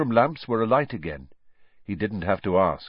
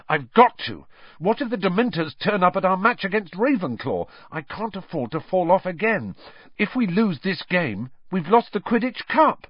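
higher, louder, urgent insistence; fierce and urgent; 9 semitones higher, 3 dB louder than usual. Acted. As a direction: insist you must go on.

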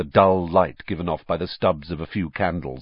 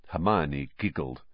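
usual speaking voice

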